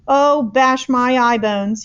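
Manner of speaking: loud, slow, distressed; distressed cry. A narrator's reading. Giant waving the jar, distressed. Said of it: "he cried".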